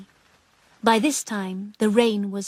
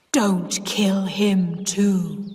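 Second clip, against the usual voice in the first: deep ghost voice; much slower than usual. Acted. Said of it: "she said in a deep voice".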